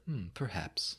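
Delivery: soft, pensive imitation of a mutter. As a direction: murmur it as if musing to yourself. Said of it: "you hear him mutter".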